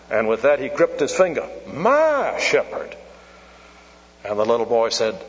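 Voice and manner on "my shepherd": "my" high and drawn out, "shepherd" dropping; stress on my. Retold or imitated. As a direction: hammer home a long, soaring "my". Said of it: "Put the emphasis where it ought".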